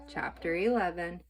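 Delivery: usual speaking voice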